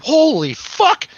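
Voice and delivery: high, loud, drawn-out mock shock; retold stunned shock. Reliving the hallucinated crowd on acid, retold stunned shock.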